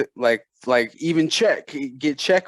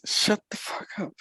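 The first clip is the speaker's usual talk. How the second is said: whispered aside; 6 semitones higher, 6 dB quieter than usual. Real, not acted. Telling a teasing friend to shut up, whispered. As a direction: whisper it sharply.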